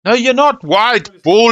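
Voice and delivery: sharp high outburst of denial; loud protest. Real, not acted. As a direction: shout it down in disbelief.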